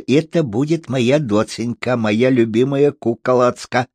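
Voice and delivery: сюсюкающая девочка, «ц» вместо «ч» в «доценька»; сюсюкай, смягчая звуки в «доценька» и «куколацка»; сюсюканье. A narrator's reading. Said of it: "и сюсюкала". (по-детски сюсюкая, «доценька» через «ц»)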